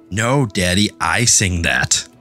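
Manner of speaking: ordinary talk, nothing marked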